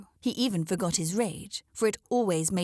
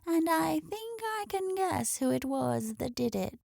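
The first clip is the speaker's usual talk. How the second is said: put-on knowing old hen; 8 semitones higher than usual.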